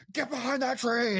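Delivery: high urgent mock order; a quick hammed-up Hagrid yelling at the kids. Host quoting Hagrid ordering kids behind tree, high mock yell.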